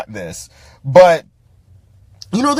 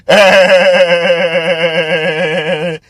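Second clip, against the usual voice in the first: steady, drawn-out laugh; long laugh; 3 dB louder than usual. Real, not acted. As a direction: let a long mocking laugh roll. Laughs while mocking the film.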